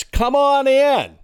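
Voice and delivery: high, slow mock welcome, a light imitation of a trusting homeowner; drawn-out lightly voiced "come on in", as if opening the door; welcoming homeowner. Homeowner welcoming the police to the door, light mock welcome. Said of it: "and it's".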